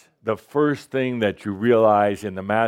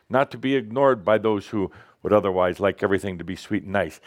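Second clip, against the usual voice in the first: ordinary delivery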